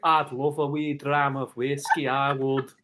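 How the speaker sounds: slow, relishing Scottish lilt; put-on Scottish accent. Macbeth auditioner accepting whiskey, put-on Scottish accent. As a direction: draw it out in Scottish. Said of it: "do you want me to do the accent".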